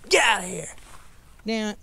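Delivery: loud, high frustrated shout at the fish; exasperated outburst; angry yell. Real, not acted. Fish jumps with his hook.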